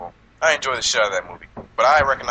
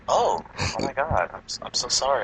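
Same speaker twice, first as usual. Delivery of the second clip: apologetic stewardess; 4 semitones higher, 3 dB quieter than usual. Pretend. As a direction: play a flustered, over-sorry attendant.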